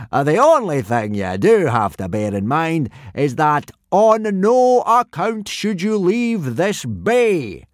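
big Viking teacher warning of danger; booming Gobber warning. A narrator's reading.

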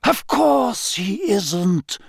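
high, drawn-out theatrical retort; thrilled professor. Acted. Trelawney looming over Harry, thrilled and theatrical. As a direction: pronounce it slowly in a high, excited voice. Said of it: "looking thoroughly excited".

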